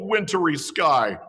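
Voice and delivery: plain reading voice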